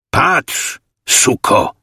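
a sharp angry snarl, jumping high on the first word; snarled command. A narrator's reading. The leader snarls at the woman, forcing her to look. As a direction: growl it at her like a torturer. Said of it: "i warknął".

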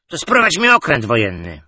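loud tipsy command; drunken blurt. A narrator's reading. Drunk man ordering a warship, loud. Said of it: "wypalił".

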